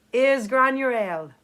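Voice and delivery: ordinary delivery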